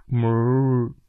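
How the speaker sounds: a slow imitated cow moo. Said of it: "哞儿哞儿声". (模仿母牛哞儿的叫声)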